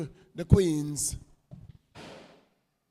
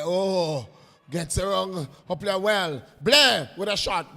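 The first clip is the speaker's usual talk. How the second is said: rising higher and louder into the shot; building excitement; 4 semitones higher, 6 dB louder than usual. Real, not acted. Commentator calling Blair's run, louder and higher. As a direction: ride the attack up to the shot.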